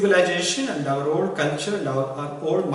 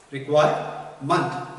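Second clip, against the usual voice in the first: "Requirement" slowly split before "ment"; syllable-by-syllable; 3 semitones lower, slower than usual. Shown as a demonstration. Teacher shows the class the suffix ment, slow broken-up "Requirement".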